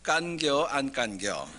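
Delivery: slow, amused Chungcheong drawl; Chungcheong dialect. Retold or imitated. Preacher jokes about Chungcheong brevity, slow amused Chungcheong drawl. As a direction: stretch it out like a laughing Chungcheong local. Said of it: "충청도가 제일 심플한데요".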